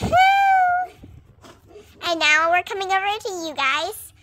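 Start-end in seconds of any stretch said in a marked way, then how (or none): 0.0-0.9 high shouted whoop. Acted. Horse galloping off with a high joyful shout. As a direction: let out a big joyful yell.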